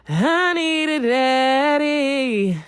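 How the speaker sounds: high, slow, drawn-out plea; mock needy voice. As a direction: stretch it out like a begging girl. Someone mocks needy voice.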